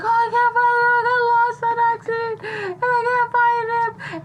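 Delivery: high-pitched fake lost-child wail; hammed-up tearful little girl; pretend crying. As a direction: whine like a kid pretending to cry. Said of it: "so when she cries".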